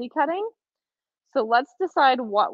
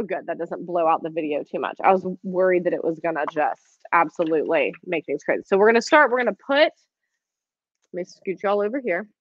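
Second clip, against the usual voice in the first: ordinary talk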